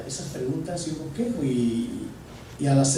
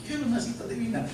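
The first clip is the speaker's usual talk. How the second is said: pleading prayer; 9 semitones higher than usual. Retold or imitated. Pastor retelling his prayer to God, higher and eager. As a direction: beg God for a divine appointment. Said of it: "Yo le decía al Señor".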